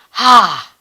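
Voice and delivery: short surprised exclamation; delighted ha. Shown as a demonstration. Teacher demonstrating a happy surprise. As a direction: blurt it out in delight.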